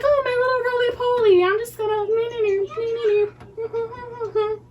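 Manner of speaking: put-on high sing-song nee nee nee to a baby; baby talk. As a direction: coo and babble at the baby. Mom cooing at baby.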